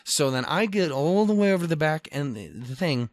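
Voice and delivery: ordinary talk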